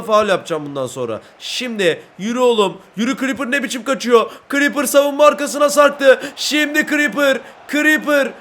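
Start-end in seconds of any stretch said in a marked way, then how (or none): 4.5-8.3 higher and louder, stretching the goal call; live goal call, drawn out at the end; excited goal call. Real, not acted. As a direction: build up and shout the goal. Commentary building to goal.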